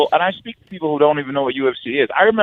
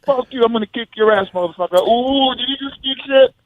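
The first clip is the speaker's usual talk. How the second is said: hyped trash talk; 9 semitones higher than usual. Retold or imitated. Mock trash talk fighter.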